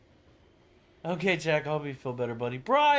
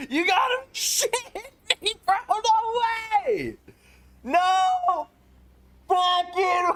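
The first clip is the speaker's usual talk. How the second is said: excited yelling; 12 semitones higher than usual. Real, not acted. Streamer screaming with joy at another huge donation.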